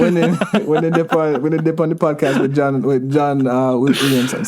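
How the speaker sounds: Jamaican patois through a laugh; amused, loose Jamaican accent. Shown as a demonstration. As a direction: playfully stumble along in Jamaican patois. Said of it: "I'm just gonna do it now".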